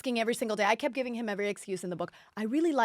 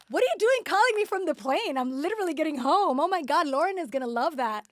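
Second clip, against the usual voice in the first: excited gush; 6 semitones higher than usual. Retold or imitated. Retelling her excited reaction.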